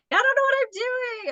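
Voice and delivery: high-pitched imitation of a panicking man; mock panic. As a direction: wail it like a hammed-up lost shopper. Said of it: "You were just in panic mode".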